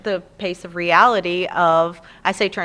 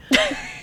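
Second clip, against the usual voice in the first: playful imitated pig oink; short comic snorts. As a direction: snort twice like a cartoon pig. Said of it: "calls me Mommy Pig".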